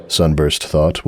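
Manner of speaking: usual speaking voice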